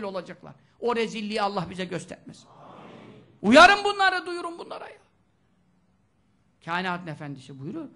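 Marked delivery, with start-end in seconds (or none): 3.4-5.0 loud, much higher call to warn; urgent shout. Acted. Preacher urges the congregation to warn others, shouting. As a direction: shout the command to warn them.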